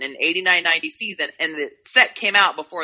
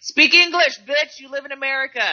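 higher, louder mock heckler; 9 semitones higher, 4 dB louder than usual. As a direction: bark it like a heckler. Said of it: "Was that racist?".

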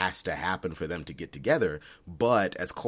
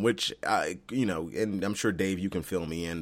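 ordinary talk

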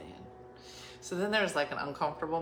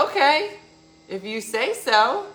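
sarcastic; 8 semitones higher, 10 dB louder, slower than usual. Retold or imitated. Skeptical reply to landscaper.

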